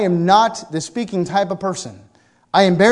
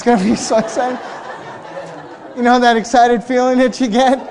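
fast, laughing and louder; laughing excitedly; 6 semitones higher, 6 dB louder than usual. Real, not acted. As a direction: bubble over with giddy laughter.

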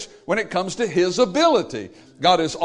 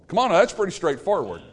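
ordinary talk, nothing marked

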